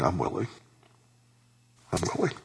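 very soft, gentle answer; tender reassurance. Retold or imitated. Preacher voices Jesus answering the sufferer, softly reassuring. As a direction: say it quietly, close and kind.

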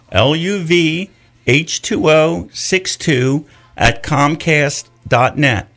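slow, letter by letter; spelled out slowly. Shown as a demonstration. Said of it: "my email address".

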